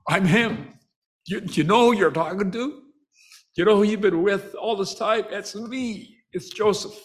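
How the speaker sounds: high, emotional voice of a brother revealing himself; imitated tearful reveal. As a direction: act out a choked-up confession, pitched high. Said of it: "he starts to cry".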